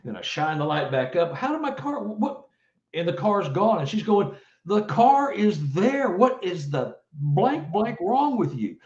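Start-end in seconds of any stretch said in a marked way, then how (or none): 1.3-2.5 high, cut-off stunned question; startled confusion. Retold or imitated. Husband lighting the empty spot, startled confusion. Car vanishes again, retold. 4.6-8.8 slow, high, exasperated scolding; exasperated wife. Retold or imitated. Wife scolding husband who can't see the car, exasperated.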